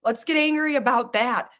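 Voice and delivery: ordinary talk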